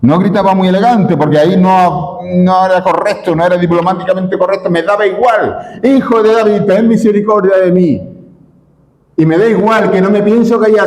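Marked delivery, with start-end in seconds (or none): 5.8-10.9 desperate crying out. Acted. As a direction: beg at the top of your voice. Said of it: "más gritaba".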